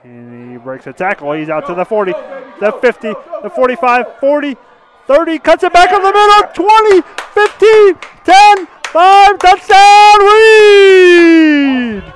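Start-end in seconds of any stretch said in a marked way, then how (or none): 1.3-12.0 building touchdown call. Real, not acted. Live touchdown run call.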